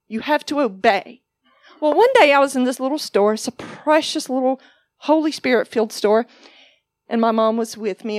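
normal talking voice

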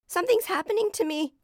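put-on high, loud, panicked child; frightened cry. A narrator's reading. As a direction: cry out for help in alarm. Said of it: "he cried".

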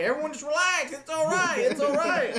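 very high, loud, strained yelling; shouted, high-pitched fake calming; mock frantic reassurance. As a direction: yell for calm in a hammed-up panic. Acts himself calming screaming classmates.

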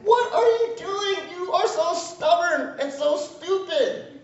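high, slow, scolding parent voice; exasperated God. Retold or imitated. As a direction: scold foolish little kids from above. God scolding stubborn children.

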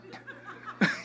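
airy laugh at his own joke; a quick amused exhale; breathy laugh. Real, not acted. Preacher laughing at the crowd's shock, an airy chuckle.

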